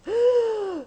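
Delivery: sharp imitated gasp for breath; labored gasp. Preacher imitating the sick child's gasp for air. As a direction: mimic a dying child's gasp.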